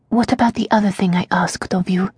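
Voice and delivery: hushed, breathless whisper; barely voiced words of despair. Prisoner Tasia to her servant, whispered.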